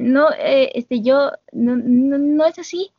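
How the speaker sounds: a mild nervous stammer; nervous denial. Retold or imitated. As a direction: fumble for words, flustered. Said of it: "Connie es visiblemente nerviosa".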